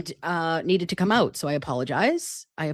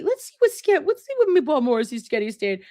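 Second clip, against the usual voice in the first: silly high reading voice; 8 semitones higher than usual. Retold or imitated. Reading a friend's message.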